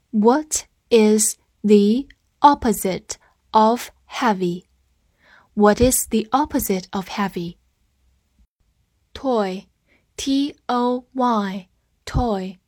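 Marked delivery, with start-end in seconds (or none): 0.1-4.6 very slow, separated words; slow reading. Shown as a demonstration. Teacher asking learners to read along, slow reading. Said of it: "跟我慢读一遍". 10.2-11.7 slow letter-by-letter spelling; spelled out. Shown as a demonstration. English teacher spelling a new word for learners, spelled out.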